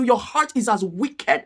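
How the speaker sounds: ordinary talk, nothing marked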